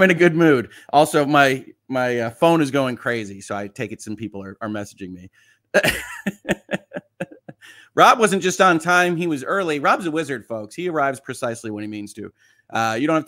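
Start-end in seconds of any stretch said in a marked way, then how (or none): 5.7-7.5 laugh. Real, not acted. Laughing at phone blowing up.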